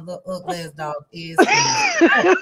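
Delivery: laughing out the line about the dog. Real, not acted. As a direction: still cracking up while talking. Laughing through the dog joke.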